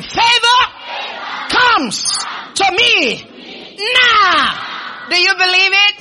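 high, loud shouted proclamation; rally the crowd to shout it back; slow, stretched declarations at full volume; full-force preaching. Acted. Leads congregation in shouted declaration.

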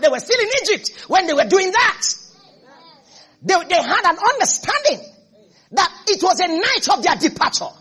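high, loud shouted sermon; fiery preaching. Acted. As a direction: preach it out to the whole house, full voice. Preaching at full force.

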